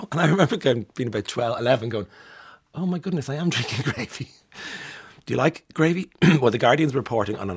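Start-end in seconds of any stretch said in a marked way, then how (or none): 2.7-4.3 mock shock. Retelling childhood gravy shock.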